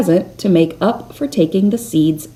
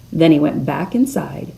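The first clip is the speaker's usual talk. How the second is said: ordinary delivery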